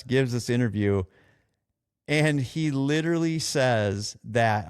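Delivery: ordinary talk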